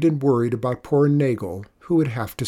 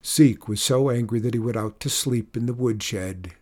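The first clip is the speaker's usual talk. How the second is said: ordinary delivery, nothing marked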